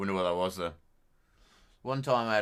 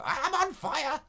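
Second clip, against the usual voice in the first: high, loud mock scream of a patient; hammed-up high screaming madman; 5 dB louder than usual. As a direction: shriek it like a madman. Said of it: "just people screaming".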